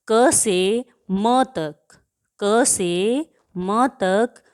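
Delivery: very slow, spelled out क से म तक; drawn out for students to note; slow emphasis. Shown as a demonstration. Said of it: "teacher stressing range slowly". (बहुत धीमे, ज़ोर देकर)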